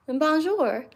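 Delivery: Bonjour in a heavy American accent with a hard r; an American tourist's Bonjour. Real, not acted. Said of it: "that was a very American accent".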